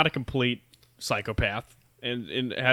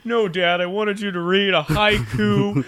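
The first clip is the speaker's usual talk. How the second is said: higher, indignant dad voice; pitched-up outraged dad; exasperated dad rant; 9 semitones higher, 4 dB louder than usual. Pretend. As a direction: rant as an offended father who gave everything.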